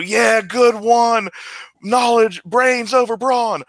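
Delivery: slow, high, loud showboat shout; grandstanding shout. Acted. Wrestler grandstands to the crowd after winning, slow high shout. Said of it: "like, you know".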